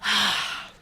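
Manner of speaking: long, audible sighing breath out; breathy, releasing sigh; deep breath sigh. Shown as a demonstration. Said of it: "Deep breath".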